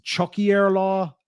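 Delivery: "Chucky air law" with air as a separate word, slow; slowly spelling out "Chucky air law"; northern pronunciation. Shown as a demonstration. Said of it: "Some people pronounce it".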